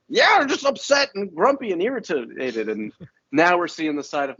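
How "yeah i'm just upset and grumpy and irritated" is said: high mock-grumpy whine; Michael. Podcaster imitating Michael after the crash, mock grumpy. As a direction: imitate someone upset and irritated. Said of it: "Michael that we saw in the previous episodes was like".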